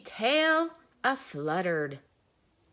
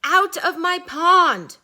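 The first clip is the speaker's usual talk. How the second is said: angry shout; 9 semitones higher, 14 dB louder than usual. Acted. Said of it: "cried Mister Duck".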